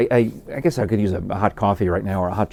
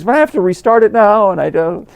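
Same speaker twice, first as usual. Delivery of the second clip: high, loud panicked apology; flustered barista; 11 semitones higher, 7 dB louder than usual. Retold or imitated. Panicked barista apologizing for shutting down the register. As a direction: squeal it out like a panicking young barista. Barista fretting about restart.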